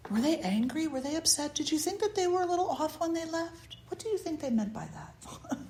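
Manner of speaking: soft, high-pitched speculating voice; fretful gossip. Retold or imitated. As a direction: imitate people wondering behind someone's back. Imagined people fretting over a goodbye.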